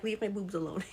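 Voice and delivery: ordinary talk, nothing marked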